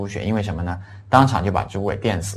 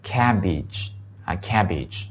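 slow model word; much slower than usual. Shown as a demonstration. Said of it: "叫cabbage".

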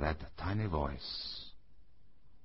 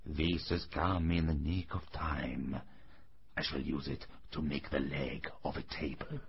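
mumbling to himself; 10 semitones lower, 6 dB quieter than usual. A narrator's reading. Old carpenter to himself about the wood, low contented mumble. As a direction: mumble low as if thinking aloud. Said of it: "he mumbled to himself".

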